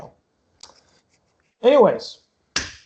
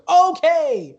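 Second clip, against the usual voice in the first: awkward backtracking; 8 semitones higher than usual. Acted. Ticket seller misread the situation, awkward backtracking. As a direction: brightly recover from a gaffe, pitched up.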